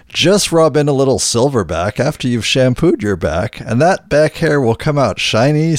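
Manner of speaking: upbeat commercial voiceover; ad read. Acted. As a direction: sell it like a radio ad. Reads a mock sponsor ad.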